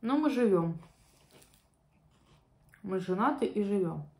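eating between bites; chewing while talking. Real, not acted. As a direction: mouth partly full. Seen 2: holds fork; lips pursed, chewing motions between bites before and after.

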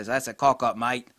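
imitating a British accent, casual and punchy; mock British. Reviewer quoting how British people say it, mock British accent. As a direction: put on a rough British accent. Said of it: "what the British people say".

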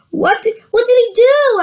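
ordinary delivery

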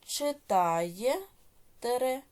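slow, drawn-out syllables with rising ends; dictation. Shown as a demonstration. Teacher dictating exercise eight to pupils, slow measured dictation. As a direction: dictate each word slowly, leaving long writing pauses.

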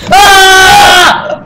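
high loud scream; startled scream. Real, not acted. Player hit by a jumpscare, high loud scream. Scare in horror game.

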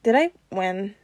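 woozy, faint question; slurred. A narrator's reading. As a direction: ask it half-conscious. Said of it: "Peter slurred".

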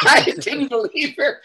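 high laugh spilling into the words. Real, not acted. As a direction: laugh in disbelief, slow. Laughing in disbelief.

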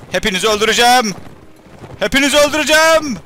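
mock battle threat. Commander threatening enemy troops mid-battle, rising mock roar. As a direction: roar it at the whole enemy army, building.

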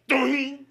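sudden ringing alert noise; high loud ding. Retold or imitated. Dog suddenly spots him, comic alert ding.

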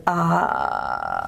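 low vocal fry; creaky voice demo. Shown as a demonstration. Teacher letting students hear every pulse of creak. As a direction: drop the pitch down into a slow creak. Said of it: "if you really listen carefully".

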